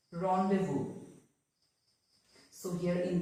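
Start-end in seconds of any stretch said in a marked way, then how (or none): 0.1-0.9 rendezvous as "ron-day-voo"; silent Z. Shown as a demonstration. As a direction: say rendezvous with no Z sound. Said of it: "is silent".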